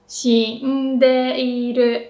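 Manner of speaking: drawn-out Shindeiru with a low shi; slow pitch demo. Shown as a demonstration. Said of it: "Shi is the only one that's low".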